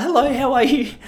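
put-on the dorky teen who fumbles his opener; awkward greeting. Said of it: "It wasn't that good".

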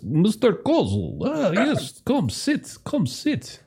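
high-pitched Russian host, swooping and drawn out; Russian accent. Acted. Game master as Karakoff welcoming a guest, high Russian accent. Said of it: "very hard to go from Russian".

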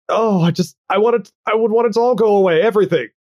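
high, rushed, flustered rant; exasperated venting. Acted. Penny venting alone at the pit, high exasperated rant. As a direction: let it tumble out high and frustrated.

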